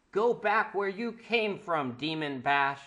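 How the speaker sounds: loud, high and slow shout; shouting command. Acted. Celestia shouts at the villain, loud and high. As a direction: order him back with full force.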